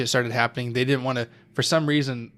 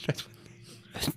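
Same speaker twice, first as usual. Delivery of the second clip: ordinary delivery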